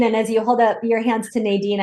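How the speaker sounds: normal talking voice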